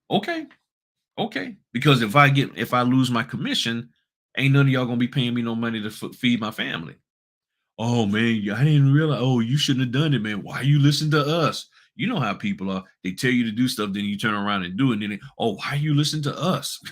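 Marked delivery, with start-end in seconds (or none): 0.1-1.6 very high-pitched, rising okay; mock okay. Mock assent to those pressuring him. 7.8-11.7 higher, exclaiming mock regret; mock innocence. Imitates people who gave bad advice. 15.4-16.8 higher, teasing imitation; mock innocence. Repeats the imitated people, then laughs.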